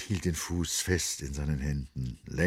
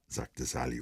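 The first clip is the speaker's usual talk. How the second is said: plain reading voice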